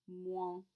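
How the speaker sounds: Moins with oi as wa plus a nasal sound; Moins, mwa, nasalised. Shown as a demonstration. Teacher drills the nasal oi sound in Moins. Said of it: "combination of an O I and a nasal sound".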